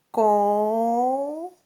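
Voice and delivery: CONTAR stretched into long, separated syllables, CON... TAR; "contar" very slowly, held CON, long pause, then TAR. Shown as a demonstration. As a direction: draw out each syllable of "contar" as if writing it. Teacher sounds out word while writing.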